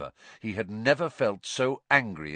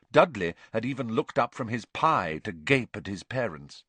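normal talking voice

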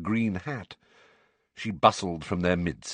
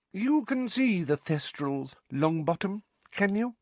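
indifferent inspector; 12 semitones higher, 3 dB quieter than usual. A narrator's reading. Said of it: "her tone indifferent".

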